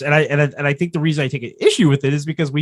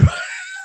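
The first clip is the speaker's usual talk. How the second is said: high squealing laugh; 4 dB quieter than usual. Real, not acted. Bursts out laughing mid-sentence.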